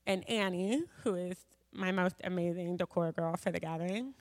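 ordinary talk, nothing marked

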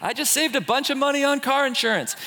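high, bright insurance-ad pitch; mock TV ad. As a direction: sell it like a commercial. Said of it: "to be like".

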